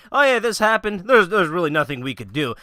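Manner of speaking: loud, high mock shrug-off; mock dismissal. Imitating those who won't talk about it, loud mock dismissal. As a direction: blurt it like someone brushing it off. Voices people shrugging off the problem.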